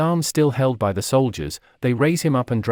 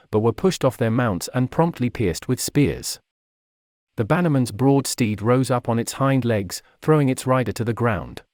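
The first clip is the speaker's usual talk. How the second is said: plain reading voice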